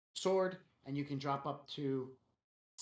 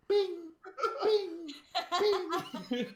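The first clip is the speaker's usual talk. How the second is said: laughing chime; 14 semitones higher, 5 dB louder, slower than usual. Real, not acted. Imitates car seatbelt chime.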